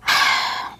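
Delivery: long, voiceless exhale; heavy sigh. Real, not acted. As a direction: let out a real sigh. Exasperated pause before explaining.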